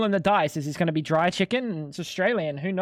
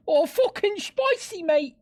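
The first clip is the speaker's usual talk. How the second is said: hammed-up high-pitched British bloke; a British lad squealing that the spice is burning him; mock British accent; 13 semitones higher than usual. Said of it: "I didn't know you were British".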